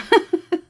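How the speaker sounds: sudden loud laugh; short self-amused laugh; burst of laughter. Real, not acted. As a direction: laugh out at yourself. Laughing at own worry.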